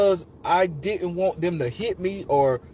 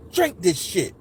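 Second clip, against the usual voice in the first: ordinary talk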